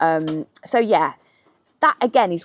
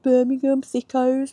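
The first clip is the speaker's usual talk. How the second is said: mock sneer; 6 semitones higher than usual. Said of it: "People hate it".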